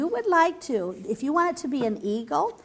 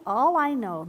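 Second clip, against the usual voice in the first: usual speaking voice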